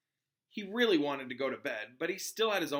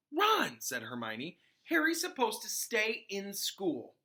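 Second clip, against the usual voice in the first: reproving; 7 semitones higher, slower than usual. Acted. Hermione warns Ron.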